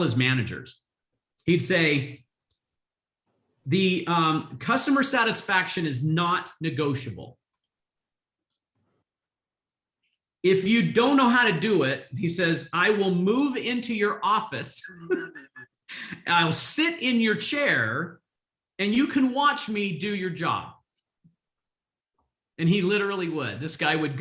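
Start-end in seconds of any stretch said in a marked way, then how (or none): 3.7-12.1 imitating a passionate boss, pitch raised; mock-insistent, laying down the law; passionate manager's boss. Retelling a hotelier lecturing his managers, passionately. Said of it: "Let me tell you how passionate this guy was". 12.7-20.7 imitated boss's ultimatum, high-pitched and emphatic; passionate manager's boss. Retelling a hotelier threatening to take a manager's chair, passionately. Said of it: "Let me tell you how passionate this guy was".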